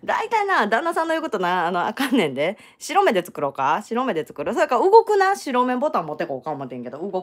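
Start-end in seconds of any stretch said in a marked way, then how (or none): none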